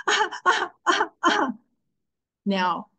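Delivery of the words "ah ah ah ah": short, high staccato sobs; high-pitched broken little cries; trua sobbing imitation. Shown as a demonstration. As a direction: imitate sobbing in quick separate bursts. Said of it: "the trua is supposed to sound like".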